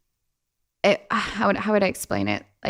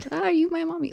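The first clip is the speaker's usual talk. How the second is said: high, soft small voice; mock little child; 9 semitones higher, 5 dB quieter, faster than usual. Joking about having many godmothers, small childlike voice. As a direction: play a little kid asking, pitched way up. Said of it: "It's just like".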